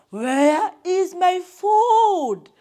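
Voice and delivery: very slow, high, loud roar of a demand; hungry lion king thundering at the hare. A narrator's reading. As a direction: bellow it with long vowels. Lion cuts in, demanding food.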